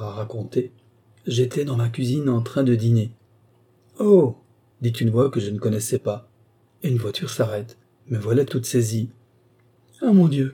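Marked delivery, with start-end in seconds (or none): 4.0-4.4 high, loud startled oh; a sudden cry from outside; exclaiming in surprise. A narrator's reading. Unknown voice exclaims. 10.0-10.5 loud, high ah mon Dieu; startled exclamation; alarmed cry. A narrator's reading. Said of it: "que je m'écrie".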